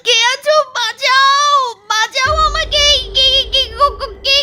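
desperate high cries for help; screaming for help. Acted. Wife screams for rescue from the ghost, terrified. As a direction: scream for anyone to save her.

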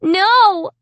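high, loud refusal; crying out. A narrator's reading. Child refusing to be the dummy's slave, crying out. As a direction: cry it out high. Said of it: "I cried".

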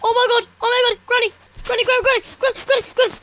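high, loud panicked screams; screaming in panic. Real, not acted. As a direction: scream as she finds you. Granny appears, panic.

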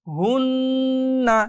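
হুন্না with the ন্ন hummed long; slow Quranic recitation; held nasal ghunnah. Shown as a demonstration. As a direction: stretch the nasal hum in হুন্না. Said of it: "গুনগুন আওয়াজ".

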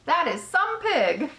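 high-pitched jubilant shouts; excited crowd yelling. A narrator's reading. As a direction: burst out cheering, one exclamation after another. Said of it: "yelled the crowd".

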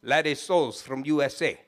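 put-on low Trinidadian old-boy voice; put-on Trinidadian accent, pitched low; mimicked Trinidadian accent. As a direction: imitate a heavy Trinidadian boss. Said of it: "I can mimic that old boy".

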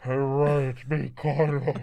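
low, slow ghostly put-on voice; Kylo Ren. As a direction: draw it out like a ghostly villain. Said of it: "your Kylo voice is very similar to your ghost voice".

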